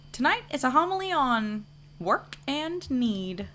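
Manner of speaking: hint of a folksy church janitor announcing the homily.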